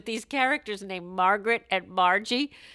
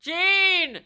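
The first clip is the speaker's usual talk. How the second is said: high, loud, drawn-out call of a name; mock sleep shout; 11 semitones higher, 5 dB louder than usual. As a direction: holler it as if crying out in sleep. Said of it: "in his sleep he's like".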